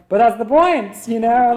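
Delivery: retorting. Retold or imitated. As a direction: toss it back as if it's obvious. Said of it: "And I'm like".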